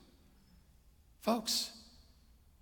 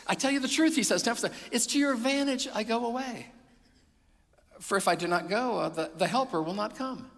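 proclaiming; 8 semitones higher, 6 dB louder than usual. Acted. Preacher voicing Jesus, proclaiming.

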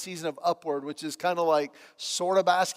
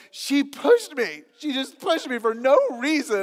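put-on high, whiny teenage girl voice, slowed; upset girl; 7 semitones higher, 5 dB louder than usual. Coach retelling a pushed player's complaint, mock whiny. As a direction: imitate a hurt girl near tears.

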